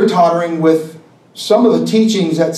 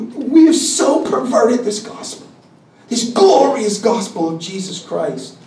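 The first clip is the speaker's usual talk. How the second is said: fighting back real emotion while preaching; emotional; 3 semitones higher than usual. Real, not acted. Preacher grieving over a perverted gospel, choked with emotion. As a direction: push the words out through a choked-up voice. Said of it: "I'm not trying to get emotion for this".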